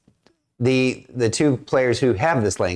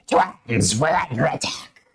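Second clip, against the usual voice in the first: Screechy voice; 5 semitones higher than usual. Acted. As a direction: redo it as Screechy. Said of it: "she would say it more like Screechy".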